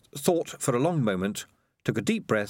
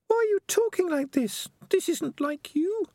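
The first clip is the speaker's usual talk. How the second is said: put-on high, earnest young rat girl; Peaches, pleading and firm; young female rat voice. A narrator's reading. Peaches worried.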